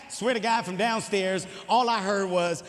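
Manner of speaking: ordinary talk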